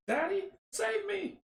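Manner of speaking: put-on small child calling for rescue; child's cry. Felicia begs her father for help, child's cry. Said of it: "Felicia cries".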